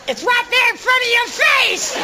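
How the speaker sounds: high, slow, exasperated wife voice; hammed-up wife pointing out the obvious. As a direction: spell it out to him slowly, fed up. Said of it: "she would go".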